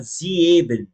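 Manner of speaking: "sieben" broken into "zi-e-ben"; wrong, E pronounced. Shown as a demonstration. Teacher showing the mistake, "sieben" with an audible extra E. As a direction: say "sieben" with the E wrongly sounded after the I. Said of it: "a gente não vai falar".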